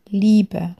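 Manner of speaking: usual speaking voice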